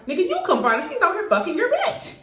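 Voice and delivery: high mock yell at him; retold yell. Yelling at the girl's man to go fight, high mock yell. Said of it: "I'm yelling at the, her man".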